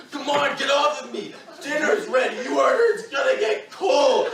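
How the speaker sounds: pinned brother shouting what he's made to say; shouted forced confession. Retold or imitated. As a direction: yell the humiliating lines to get free. Begging to go to dinner.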